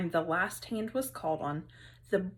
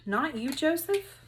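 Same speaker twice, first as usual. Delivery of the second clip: a lightly voiced surprised teacher, higher; surprised question; 6 semitones higher than usual. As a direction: ask him, surprised he didn't raise his hand. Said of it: "Missus Sims looked surprised".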